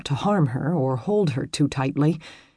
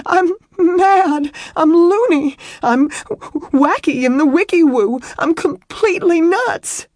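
high, hysterical wail; loud hiccuping sobs; 13 semitones higher, 8 dB louder than usual. A narrator's reading. Woman declaring to him she has lost her mind, hysterical sobbing. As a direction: cry out each phrase, breath catching. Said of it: "Her sobs grew louder, her breath hiccuping".

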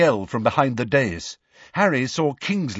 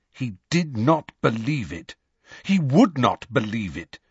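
usual speaking voice

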